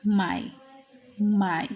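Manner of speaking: माई with an "eye" diphthong, like "my"; आई sound. Shown as a demonstration. Teacher showing the ai sound, माई said as "my". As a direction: say माई as one "my" glide. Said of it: "आई की sound होगी".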